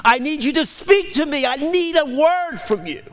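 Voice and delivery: high, drawn-out cry to God; impassioned high-pitched pleading yell; retold scream. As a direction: re-enact a desperate yell, stretching the words. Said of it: "I scream".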